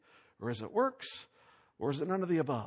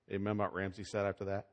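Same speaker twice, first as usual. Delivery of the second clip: normal talking voice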